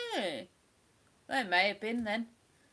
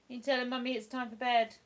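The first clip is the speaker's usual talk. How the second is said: ordinary delivery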